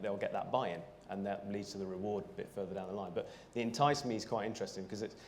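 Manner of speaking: ordinary talk, nothing marked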